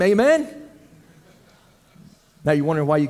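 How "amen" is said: ordinary talk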